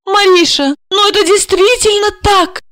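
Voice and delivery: high pleading defence; high, earnest pleading to her angry friend; desperate excuse. Acted. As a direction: plead your case desperately. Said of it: "отчаянно оправдывалась та".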